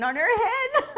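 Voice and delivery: loud, high, cracking up while talking; laughing punchline. Real, not acted. Teller explaining the beehive joke, cracking up. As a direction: burst into laughter as you finish.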